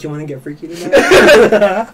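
slow, laughing flirty line; flirty come-on. Retold or imitated. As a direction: tease him, laughing and slow. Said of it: "I was like".

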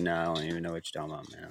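low, quick, soft deadpan denial; matter-of-fact. Acted. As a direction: brush it off quietly, totally sure of yourself. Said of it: "say stuff with a matter-of-fact tone".

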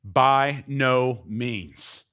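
very slow, loud, each word hit; emphatic. Retold or imitated. Preacher quoting Paul's emphatic answer, slow. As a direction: pound out every word. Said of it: "Paul answers emphatically".

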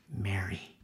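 Jesus softly saying her name; soft call. Acted.

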